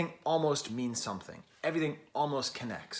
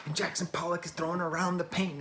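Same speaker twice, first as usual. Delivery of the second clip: mock awed realization; 3 semitones higher than usual. Critic imitating a viewer of abstract art, mock awed. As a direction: drag it out like a gallery-goer getting it. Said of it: "you just go".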